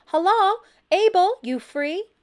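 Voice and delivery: high, loud holler across the yard; friendly loud holler to a neighbor. A narrator's reading. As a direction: call out over the wall. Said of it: "she hollered".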